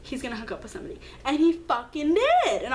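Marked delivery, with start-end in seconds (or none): 0.0-1.0 hushed inner thought. Retold or imitated. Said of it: "I was like".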